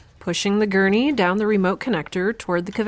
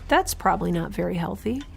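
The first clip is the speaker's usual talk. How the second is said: ordinary talk, nothing marked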